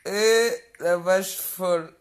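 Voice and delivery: loud and slow, every word hammered out; drawn-out mocking yell; slow loud taunt. Real, not acted. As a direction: bellow the insult slowly, word by word. Taunting a rival on live.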